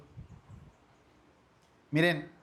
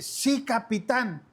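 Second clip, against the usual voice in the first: voz de niña aguda y lenta, saludo militar alegre; niña imitada; 6 semitones higher, slower than usual. Retold or imitated. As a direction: cuadrarse con alegría como soldadito. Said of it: "me dice". (la hija contesta al papá con un alegre y lento sí capitán)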